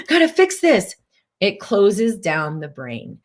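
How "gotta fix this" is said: high, urgent mock panic. Coach playing someone frantic to fix things, high urgent. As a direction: rush to fix it in alarm.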